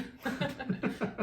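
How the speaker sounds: light real laugh; a quick amused laugh. Real, not acted. Laughs at the warm-up.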